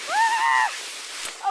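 very high shriek; shrill scream. Real, not acted. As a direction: shriek in alarm. Nearly crashing on sled.

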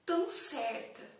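slow theatrical soliloquy with long pauses; dramatic poem recital. Acted. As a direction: recite it as an introspective monologue, swelling on key phrases. (declamação dramática de poema, pausada)

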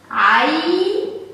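عين very slow, with the ay glide stretched; slow, elongated teaching pronunciation of عين; sounding out. Shown as a demonstration. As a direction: draw out عين before closing on n. Teacher sounds out the word slowly.

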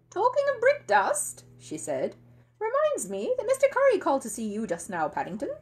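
high, loud pointed housekeeper; hint at it pointedly. Acted. Mrs Bird voiced.

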